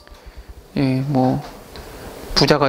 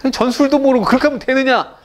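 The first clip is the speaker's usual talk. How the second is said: mock scolding; 10 semitones higher than usual. King feigns scolding.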